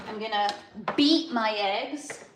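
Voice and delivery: ordinary delivery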